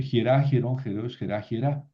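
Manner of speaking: Hierá, hierón, jerá stressed on the final syllable; rhythmic drill landing on the RÁ of Hierá; stress on last syllable. Shown as a demonstration. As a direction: keep every ending of Hierá, hierón accented. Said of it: "El acento no se mueve".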